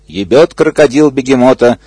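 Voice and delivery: plain reading voice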